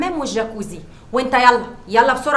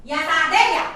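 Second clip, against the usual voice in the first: shrill scolding; 8 semitones higher than usual. Acted. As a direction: put the servants in their place. Madam berating household. (توبيخ متعالي)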